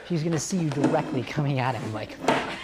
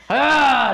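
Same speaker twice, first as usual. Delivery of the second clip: short, higher, louder ah; warm-up yell; 8 semitones higher, 6 dB louder than usual. Shown as a demonstration. Coach starts a group yell with a short loud ah. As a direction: start the energy shout. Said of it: "do that with me".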